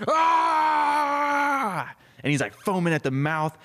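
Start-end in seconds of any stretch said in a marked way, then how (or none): 0.1-1.9 long, high, loud scream; full-voiced cry of rage; enraged scream. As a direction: lose it with one sustained wail. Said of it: "he just loses it".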